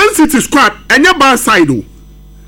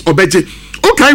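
ordinary talk, nothing marked

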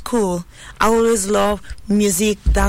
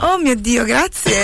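normal talking voice

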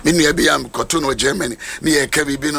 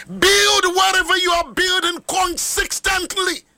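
preaching shout; 12 semitones higher than usual. Real, not acted. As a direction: hammer the point home to the congregation. Preacher exhorting congregation loudly.

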